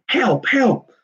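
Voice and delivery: put-on high, childish wail for help. A narrator's reading. Little Hetty wailing at her teasing brother. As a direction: cry out like a scared little girl. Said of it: "wailed Hetty".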